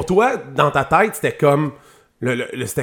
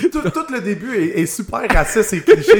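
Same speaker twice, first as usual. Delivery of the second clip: talking through real laughter, higher; amused, laughing reaction; 9 semitones higher than usual. Real, not acted. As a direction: giggle out the words in delight. Laughing at racist joke.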